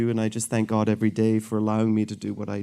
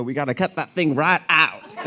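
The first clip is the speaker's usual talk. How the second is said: put-on East Texas accent; 3 semitones higher than usual. Surgeon talking to East Texas patients in a Texas accent. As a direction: talk like a good ol' Texas country doctor. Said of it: "my good East Texas folks".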